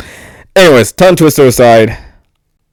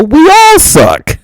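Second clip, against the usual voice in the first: slow, high, louder exclamation; joking shout; 4 dB louder, much slower than usual. Real, not acted. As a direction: stretch it out and belt it. Sums up with a loud joke.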